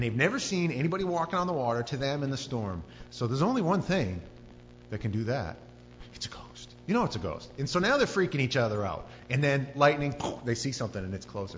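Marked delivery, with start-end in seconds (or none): 6.1-6.7 acted unvoiced whisper; frightened whisper. Disciple to crewmates on seeing a figure, whispering. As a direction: whisper it to your crewmates in dread.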